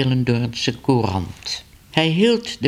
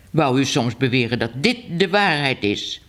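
ordinary delivery, nothing marked

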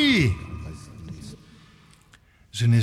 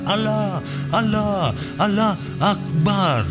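very high, drawn-out cry; appel à la prière; 12 semitones higher, slower than usual. Acted. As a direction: call it out high like a muezzin. Performer imitates call to prayer. (cri d'appel très aigu)

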